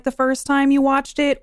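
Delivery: ordinary talk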